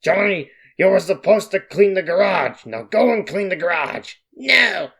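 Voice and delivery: acted scolding father in a put-on voice; arguing. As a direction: scold the son like a cartoon dad. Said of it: "Scene cuts to Johnny and Papa arguing".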